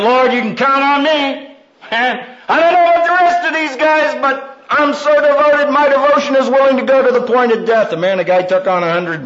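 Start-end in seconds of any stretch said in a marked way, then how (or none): 0.0-7.9 high, fast bragging pledge; boastful Peter. Retold or imitated. Peter boasting to the Lord, fast and high. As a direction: oversell your devotion, racing ahead. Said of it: "the man who said".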